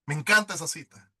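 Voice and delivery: usual speaking voice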